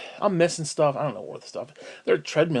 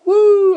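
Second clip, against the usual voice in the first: loud high-pitched wrestling whoop; Ric Flair woo; 17 dB louder than usual. Retold or imitated. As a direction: imitate a wild, drawn-out "woo". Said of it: "wooing like Rick motherfucking Flair".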